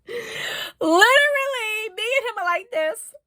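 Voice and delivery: high, squeaky laughing speech; cracking up. Real, not acted. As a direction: squeeze the words out through helpless laughter. Cracking up over strip-search proposal.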